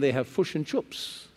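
put-on New Zealand vowels, "fish and chips" as "fush and chups"; New Zealand accent. Shown as a demonstration. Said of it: "In New Zealand, they have".

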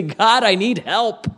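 acted desperate appeal; bold pleading prayer. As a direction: plead boldly, as if demanding help. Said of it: "boldly approach the throne of God and say".

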